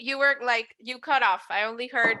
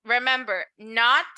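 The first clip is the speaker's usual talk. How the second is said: ordinary delivery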